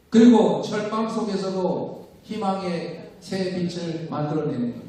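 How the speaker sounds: ordinary delivery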